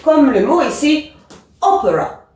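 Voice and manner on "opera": "opera" with a strong O, falling after; stress on first syllable. Shown as a demonstration. Teacher models English opera, first syllable stressed. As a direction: lean hard on the start of "opera". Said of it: "bien insister sur le début".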